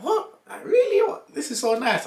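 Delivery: high squealing what, really; shocked delight. Retold or imitated. Office guys squealing over free doughnuts, shocked and delighted. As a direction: gasp it out like stunned staff.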